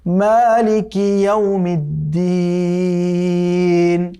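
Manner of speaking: slow, drawn-out melodic tajweed chant; chanted Quranic recitation. Shown as a demonstration. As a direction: recite the verse again slowly, melodic and measured. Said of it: "আমি আবারও পড়ছি".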